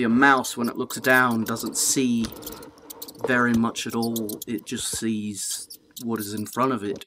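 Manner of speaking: plain reading voice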